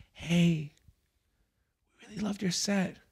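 high, sweet fan voice; lightly voiced girly fan praise; gushing fans. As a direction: gush like an excited fan.

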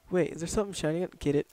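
ordinary talk

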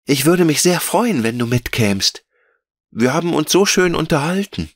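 put-on high-pitched pleading child character; Veronika, freundlich bittend. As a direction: coax a friend to come along, in a child's high voice. Voices Veronika in story. (hohe Kinderstimme, herzlich bittend)

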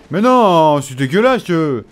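loud indignant cry of unfairness; outraged protest. Real, not acted. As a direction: complain loudly at the cheap hit.

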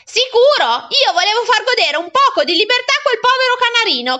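put-on high, loud, protesting boy; aggrieved child pleading innocence at full voice; self-justifying boy. Boy character defends himself.

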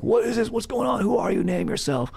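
mock-terrified high-pitched whaler; scared whaler. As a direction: squeal it like a spooked sailor seeing ghosts. Frightened whaler voiced in story.

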